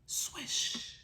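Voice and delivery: breathless swish of the sea; storybook swoosh noise; whoosh sound. Acted. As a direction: breathe out a splash of water. Tossed in the sea, water noise.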